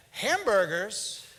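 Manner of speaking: slow, drawn-out clueless question; dumb husband. Retold or imitated. Wife mocks husband's question, slow and clueless. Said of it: "you're like".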